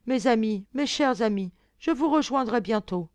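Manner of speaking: loud grieving cry to lost friends; sobbing despair. Acted. Blondine, sobbing, calls out to her dead friends, loud. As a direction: wail it out through tears.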